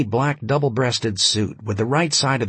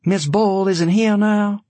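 slow, higher, brushing it off; dismissive; 10 semitones higher, 4 dB louder, slower than usual. A narrator's reading. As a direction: ignore the question, curtly. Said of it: "said Maria, ignoring the question".

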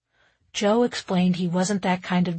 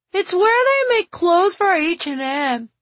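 put-on high, loud kid calling through a door; loud, high-pitched little-kid voice; child's voice; 10 semitones higher, 6 dB louder than usual. A narrator's reading. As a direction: voice a child piping up from another room. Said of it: "Timby's voice through the cracked door".

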